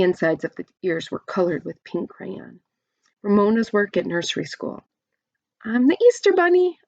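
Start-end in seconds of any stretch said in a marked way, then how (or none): 5.6-6.8 much higher, louder proud declaration; proud announcement. A narrator's reading. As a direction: announce it like a proud little child. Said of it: "announced Ramona".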